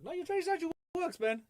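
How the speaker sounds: exasperated rebuttal. Acted. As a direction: shoot back in a high, hurried pitch. Rebuts the imagined trader's excuse.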